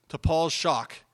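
ordinary talk

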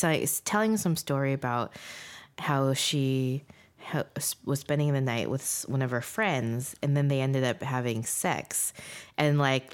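normal talking voice

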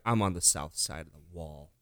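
low, soft, crestfallen mumble; deflated realization. Retold or imitated. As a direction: sink into sudden defeat. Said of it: "you're like".

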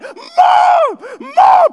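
put-on very high, very loud childish shriek; kid screaming Mom. Brother screaming for mom again, very high and loud. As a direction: shriek for mom like a desperate little boy. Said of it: "my brother's freaking out".

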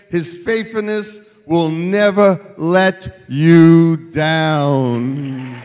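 loud, declamatory preacher building to a slow finish; full-force preaching. Real, not acted. Preacher at building dedication, loud ringing proclamation. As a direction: proclaim it loudly to the whole church.

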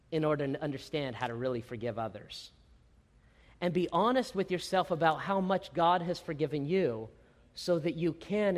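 usual speaking voice

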